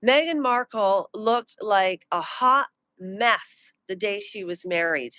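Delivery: normal talking voice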